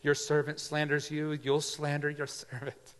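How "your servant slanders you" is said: normal talking voice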